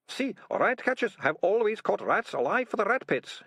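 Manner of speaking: high whining complaint; moaning. A narrator's reading. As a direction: moan it out defensively. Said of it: "he moaned".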